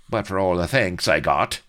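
bitter complaint. A narrator's reading. Blood complaining to Avery about thanks, bitter. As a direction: grumble it out loud, bitterly. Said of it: "Blood went on bitterly".

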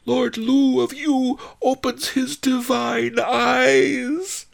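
high, slow, drawn-out shriek; slow shrieked announcement; shrieked proclamation. A narrator's reading. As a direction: shriek it out like a herald. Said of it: "they shrieked at the tops of their lungs".

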